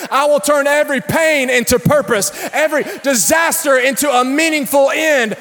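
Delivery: high, loud shouted sermon climb; full-force preaching. Real, not acted. Pastor to congregation, loud shouted preaching. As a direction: preach it hard to raise the room.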